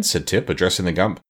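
ordinary delivery

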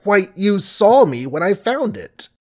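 mild flustered stammer. Acted. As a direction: caught off guard, fumbling. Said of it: "Billy stammered".

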